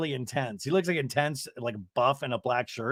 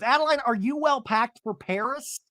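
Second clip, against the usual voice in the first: high, singsong mock-motherly question; acted-out mother; 8 semitones higher than usual. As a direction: pitch it up, imitating a fussing mom. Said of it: "And she goes".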